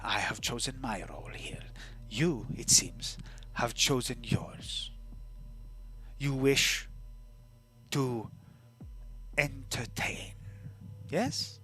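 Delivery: put-on hushed, accented storyteller of their own past; measured game character. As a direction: speak softly and deliberately, in no hurry.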